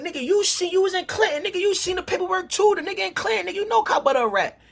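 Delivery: rally the crowd against him; heated callout. Retold or imitated. To the Howard friends about Cobb Butter's paperwork, a high, heated callout. Retold telling crowd he's rat.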